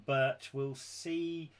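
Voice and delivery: normal talking voice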